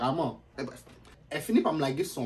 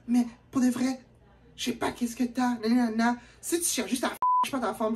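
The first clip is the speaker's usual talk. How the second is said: put-on high accusing girlfriend voice; mocking the girl; 7 semitones higher than usual. Storyteller imitating the girl accusing him. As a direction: whine it pitched up.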